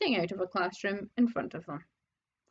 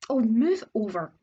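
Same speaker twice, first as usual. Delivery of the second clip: snarling; 4 dB louder than usual. Acted. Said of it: "Hermione snarled".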